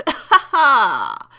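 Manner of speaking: loud laughing taunt; cackling gloat. Real, not acted. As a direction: laugh it out at the fallen enemy. Gloating laugh after the kill.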